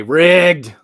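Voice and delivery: mock accusation. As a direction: belt out the accusation as a joke. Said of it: "and just say".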